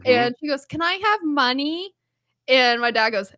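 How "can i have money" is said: put-on a touch higher little-girl ask; lightly voiced child. Niece asking grandpa for money, lightly voiced child. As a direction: ask like a small kid, lightly done.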